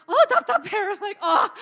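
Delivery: high, loud mock lament; hammed-up chest-beating wail; imitating a wailing ajumma. Teacher imitating Korean ajummas grieving, high and loud. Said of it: "you know, like".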